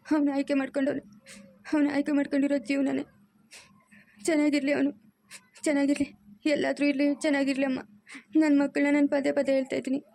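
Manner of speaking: hurt mother talking through tears; crying. Acted. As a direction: weep as you protest your love. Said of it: "ಅಳ್ಬೇಡಮ್ಮ".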